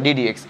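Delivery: ডি ডি এক্স said softly as dee dee ex; correct reading. Shown as a demonstration. Teacher demonstrates to class how to read ডি ডি এক্স, softly. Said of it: "উচ্চারণটা এভাবে করবে".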